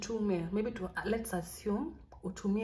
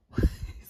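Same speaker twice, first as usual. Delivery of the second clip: whispered laugh; 8 dB louder than usual. Real, not acted. Laughs after admitting lie.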